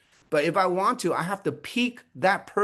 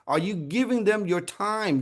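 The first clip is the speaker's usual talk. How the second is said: ordinary delivery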